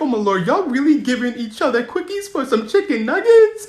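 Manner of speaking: high-pitched incredulous question; scandalized disbelief. Retold or imitated. Aghast at friends' deal.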